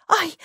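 high, short "ow" of pain; pained yelp. A narrator's reading. Girl stubs her toe on something hard, pained yelp. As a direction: yelp without much volume. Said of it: "вскрикнула она".

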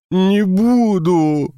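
high, slow, tearful howling plea; whiny childish wail. Acted. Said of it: "провыл с плаксивыми детскими интонациями".

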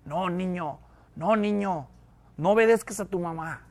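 lightly voiced devil on the shoulder; devil to a child. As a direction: quietly coax a child, as a little devil. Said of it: "el diablo no va a venir y te va a decir".

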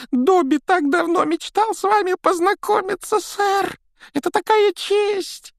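very high, squeaky elf voice; eager little house-elf, squeaking. A narrator's reading.

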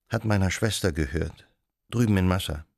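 ordinary delivery, nothing marked

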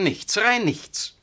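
drawn out and rising, each word weighted; slow bitter emphasis; slow emphatic stress. Acted. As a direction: spell it out with scorn. Vicar's bitter reproach.

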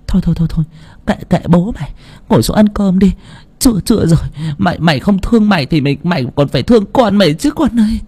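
put-on high, pleading old woman voice; old mother. A narrator's reading. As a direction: coax her daughter gently as an old mother. Mother comforts daughter. (bà mẹ dỗ con gái, giọng cao)

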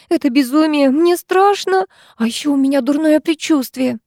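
scared girl, acted in narration; frightened. A narrator's reading. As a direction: voice lifted with dread. Frightened Diana refuses to go.

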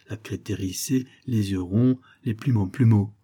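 ordinary delivery

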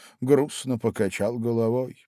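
usual speaking voice

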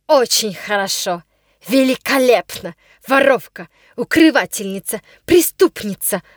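loud, accusing governess voice; angry shouting. A narrator's reading. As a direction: hurl each accusation at the girl. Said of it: "кричала она". (гневный крик)

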